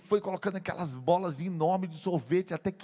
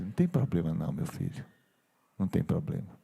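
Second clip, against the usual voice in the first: choked-up forgiveness; 11 semitones lower than usual. Acted. He forgives his grandson likewise, moved.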